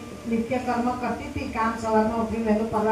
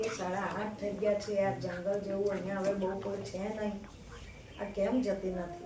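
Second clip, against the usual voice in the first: hushed divine voice; 3 semitones lower, 9 dB quieter than usual. Acted. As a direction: muse quietly to yourself in the dark forest. God wonders, unseen, at night.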